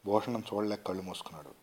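usual speaking voice

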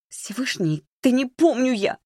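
high-pitched, heated protest; exasperated protest. A narrator's reading. Маришка pressed about his clothes, exasperated. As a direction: burst out in cornered exasperation. Cornered girl protests under questioning.

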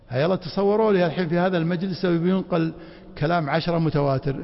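ordinary talk

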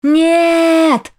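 loud, high shout. A narrator's reading. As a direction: scream it out. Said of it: "закричала".

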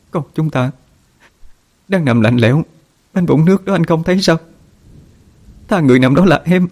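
a mother's desperate, tearful outcry; anguished wailing. Acted. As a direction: cry out in despair at him.

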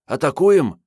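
put-on young woman voice. A narrator's reading. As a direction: blurt the question out. Marietta asks, startled.

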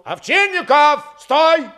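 high, loud, stretched call; shouted halt order. A narrator's reading. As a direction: shout after a rider to stop him. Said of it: "он крикнул".

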